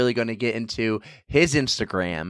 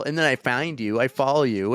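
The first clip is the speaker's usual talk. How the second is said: normal talking voice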